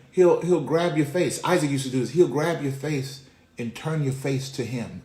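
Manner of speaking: usual speaking voice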